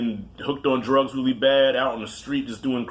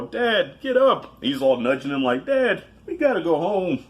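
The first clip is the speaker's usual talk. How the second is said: high, pleading cub voice, rising to a cry; a little lion cub begging his dad to wake up; whimpering kid voice calling for dad; Simba imitation; 5 semitones higher than usual. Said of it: "that was my Simba imitation".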